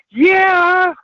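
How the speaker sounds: a drawn-out, high "yeah", half-mocking; emphatic yes. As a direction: hit the yes high and proud. Retold emphatic yes to partner.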